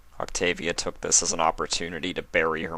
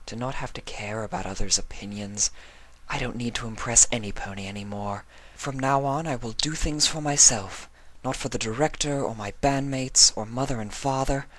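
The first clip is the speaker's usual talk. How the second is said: higher-voiced mare, resolving to live for herself; Octavia's thoughts; 6 semitones higher than usual. Octavia vowing to herself to stop pleasing others, higher voice.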